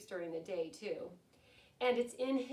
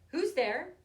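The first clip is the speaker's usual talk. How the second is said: calling out; 5 semitones higher, 9 dB louder than usual. Acted. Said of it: "Mouse cries".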